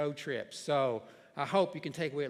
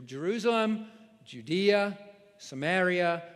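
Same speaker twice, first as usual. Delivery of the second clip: each place name punched louder; emphatic list; 3 semitones higher, 4 dB louder than usual. Acted. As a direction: hit every place like a milestone. Quoting Jesus, listing places.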